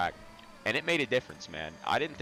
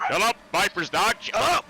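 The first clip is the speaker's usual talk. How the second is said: loud, high-pitched shout; yelling; 12 semitones higher, 9 dB louder than usual. Real, not acted. Player telling a friend's barking dog off, yelling. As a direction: yell sharply over the noise, pitch jumping up.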